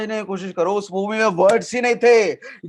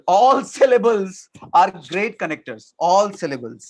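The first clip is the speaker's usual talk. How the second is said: slow emphasis; much slower than usual. Shown as a demonstration. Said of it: "trainer stresses key line".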